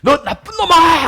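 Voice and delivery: acted boy's yell, high, loud and slow; angry cursing. Boy yells at his friend from the hill, mock angry. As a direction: shout it out to the far hill. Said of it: "욕을 합니다".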